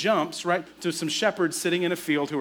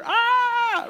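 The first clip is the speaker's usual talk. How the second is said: high piercing mock scream of frightened shepherds; kids' scream; 5 dB louder than usual. Shepherd kids shrieking at the angel, mock scream. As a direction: shriek like a terrified child. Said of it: "they just screamed bloody murder".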